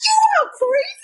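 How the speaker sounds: high, thrilled fan exclaim; high gushing squeal. Retold or imitated. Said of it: "I was like".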